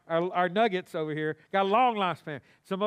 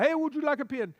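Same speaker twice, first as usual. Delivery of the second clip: put-on failed Russian accent; 8 semitones higher, faster than usual. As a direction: hawk it in a poor Russian accent. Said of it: "that's not their accent".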